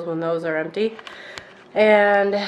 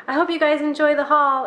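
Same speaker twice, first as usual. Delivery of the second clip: bright outro; 9 semitones higher, 5 dB louder than usual. Real, not acted. Upbeat outro.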